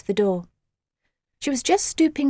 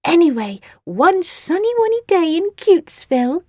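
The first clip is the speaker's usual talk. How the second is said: put-on baby talk; 7 semitones higher, 5 dB louder than usual. Sugary baby-talk story.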